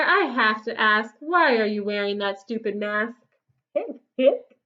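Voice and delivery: ordinary delivery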